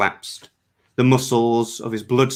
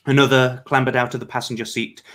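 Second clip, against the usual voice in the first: ordinary delivery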